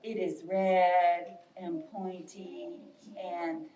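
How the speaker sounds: low, slow, subdued bear voice. Acted. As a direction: answer low and slowly as the bear. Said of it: "And Bear says".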